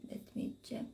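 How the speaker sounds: whispered aside, barely voiced; muttered to herself. Real, not acted. Checking a word mid-stream, whispering to herself. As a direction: drop to a breathy murmur while looking something up. Pauses stream to look something up.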